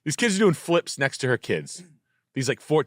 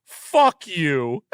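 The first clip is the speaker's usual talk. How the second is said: slow, drawn-out, higher mock sneer; bratty teen; 6 semitones higher, much slower than usual. Teen snowboarder sassing the Karen mom, slow mocking sneer. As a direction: drag out the insult like a cocky fourteen-year-old.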